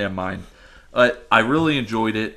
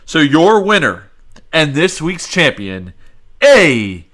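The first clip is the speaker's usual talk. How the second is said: loud, slow results announcement; announcer reveal; 7 dB louder than usual. Acted. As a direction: build up to the winner.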